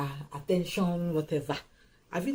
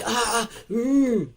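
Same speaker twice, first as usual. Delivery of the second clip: mock gushing squeals, higher and louder; over-enthusiastic; 4 semitones higher, 5 dB louder than usual. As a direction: imitate someone far too keen. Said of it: "your enthusiasm is just too much".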